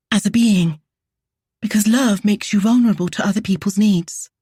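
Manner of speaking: high, fast, shaky confession; shaking voice. A narrator's reading. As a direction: let the voice shake, pushing on. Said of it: "Her voice shook".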